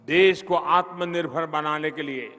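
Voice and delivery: usual speaking voice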